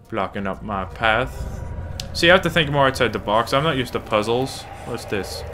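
ordinary delivery

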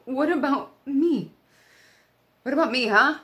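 loud, high, hurt outburst; anguished protest. Acted. Kayleen begs Doug not to marry that girl, anguished protest. Hurt plea to unconscious friend.

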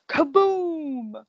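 kaboom high and loud, long falling tail; booming thunderclap. A narrator's reading. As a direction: let it burst out like thunder, drawn out. Thunder crash voiced in the story.

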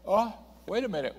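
high, startled "Oh, wait a minute"; realizing he misread the verse, startled; sudden self-correction. Real, not acted. Preacher catches his misreading.

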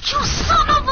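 high, loud outburst; loud, high cry of frustration; outraged shout. Real, not acted. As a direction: yell it in high-pitched outrage. Outburst at losing in game.

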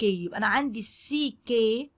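ordinary talk

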